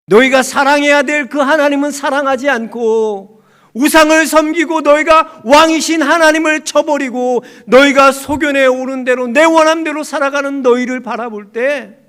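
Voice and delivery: high, very loud, driving indictment; full-force preaching. Acted. God rebuking Israel for serving idols, full-force preaching cry. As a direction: thunder the rebuke in God's voice.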